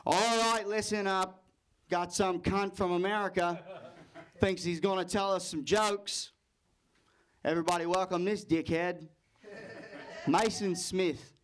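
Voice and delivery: scornful Australian host. Acted. Host as imagined by nervous comic, scornful Australian accent. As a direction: sneer the introduction in an Australian accent. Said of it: "Now, in my head, I heard".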